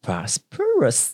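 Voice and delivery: Prosperous drawn out slowly, syllable by syllable; slow careful demo. Shown as a demonstration. 教听众英文时，慢慢示范念Prosperous. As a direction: spell the word Prosperous out slowly for learners. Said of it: "大家跟我们一起念十次".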